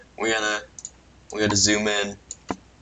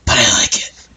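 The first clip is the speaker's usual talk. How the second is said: louder cartoon approval; cheerful approval; 2 semitones higher, 6 dB louder than usual. Character loudly approving the magic. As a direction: pop it out happily.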